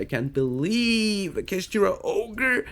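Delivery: lightly voiced impressed friend, slowing down mid-sentence; hyped-up friends. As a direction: play his homies gushing over the pulls. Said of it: "They will be like".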